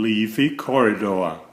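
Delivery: plain reading voice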